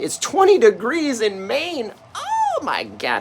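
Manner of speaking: high, loud gasp of disbelief; surprised. Real, not acted. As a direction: react to the cold with shock. Shock at the cold.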